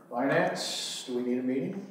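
ordinary talk, nothing marked

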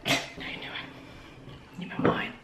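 slow hushed whisper. Real, not acted. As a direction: barely breathe the question. Whispering to child at table.

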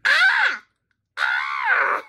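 high, loud cry of alarm; startled shriek. Real, not acted. Viewer reacting to the screen, startled shriek. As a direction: let out two sharp screams.

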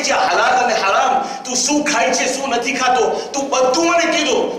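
rising preacher's voice, pressing each point; preaching. Acted. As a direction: drive the lesson home to the congregation. Preaches Bahlol's lesson.

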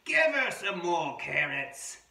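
loud, high, slow amateur Australian narration; attempted Australian accent. Reader voicing a carrot-demanding wombat for children, loud pretend Australian accent. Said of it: "not sure if this is a real Australian voice".

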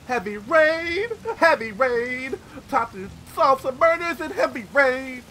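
mock detective gusto. Mock detective catchphrase, teasing.

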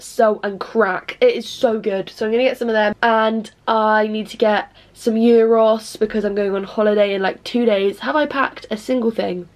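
ordinary talk, nothing marked

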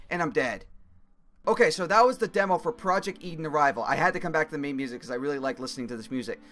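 ordinary talk, nothing marked

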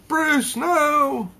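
high, loud cry to stop Bruce; acted yell. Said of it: "Leslie Tompkins yells".

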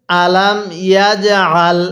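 slow "আলাম ইয়াজআল", bounced "j"; model recitation. Shown as a demonstration. Teacher has learners read along, slow measured "আলাম ইয়াজআল". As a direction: draw out "আলাম ইয়াজআল" syllable by syllable. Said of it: "আরেকবার পড়ুন".

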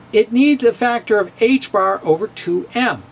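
normal talking voice

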